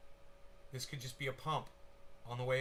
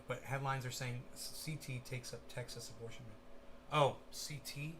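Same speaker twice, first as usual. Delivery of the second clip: ordinary talk, nothing marked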